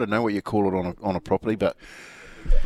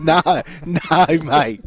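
laughing no, no, mate; telling the fan no while laughing; laughing denial; 6 semitones higher, 5 dB louder, much slower than usual. Retold or imitated.

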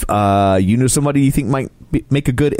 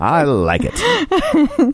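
sly delight; much slower than usual. Real, not acted. Joking after sounding dirtier.

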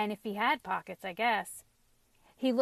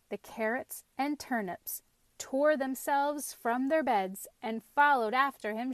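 ordinary delivery, nothing marked

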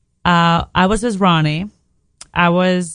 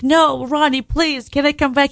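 higher, mild mock plea; pleading with Ronnie; 7 semitones higher than usual. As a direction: beg him lightly, pitched up. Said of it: "And I was like".

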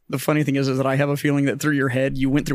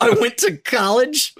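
slow, high, loud mocking whine; 10 semitones higher, 4 dB louder, slower than usual. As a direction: mimic the officer to ridicule him. Mocking the officer's line.

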